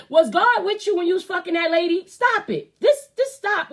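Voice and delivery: loud, high furious outburst; angry shout. Real, not acted. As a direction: yell it at him in disgust. Angry rant at the preacher.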